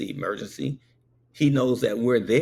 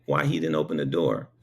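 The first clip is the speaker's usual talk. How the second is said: ordinary delivery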